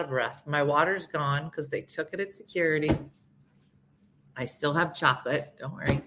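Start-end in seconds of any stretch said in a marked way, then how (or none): none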